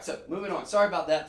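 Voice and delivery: usual speaking voice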